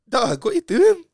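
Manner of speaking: high, loud acted-out confrontation; indignant challenge. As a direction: challenge him loudly, pitch jumping up. Confronting man who barged into mum.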